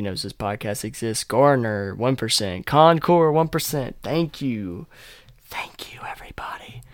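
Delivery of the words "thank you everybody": soft whispered thanks. Real, not acted. Thanking the North Carolina listeners, whispered.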